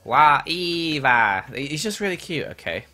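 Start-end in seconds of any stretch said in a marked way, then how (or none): 0.0-1.4 loud robot-like cry with drawn-out vowels; Wall-E. Acted. Streamer imitating a favourite Disney character, in a loud robot voice. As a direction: call out the name in a cute, stretched robot voice. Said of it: "I'm gonna say Wall-E again".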